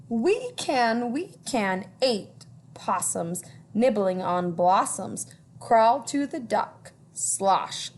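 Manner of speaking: put-on lilting read-aloud for children; storytime reading. A narrator's reading. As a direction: read it like a picture book to kids. Reading rhyming book aloud.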